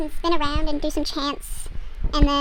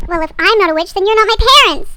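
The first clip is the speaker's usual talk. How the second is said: loud mock teen tantrum, rising higher; defiant retort; 6 semitones higher, 9 dB louder than usual. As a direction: act out a daughter yelling back. Daughter quarrels back at parents.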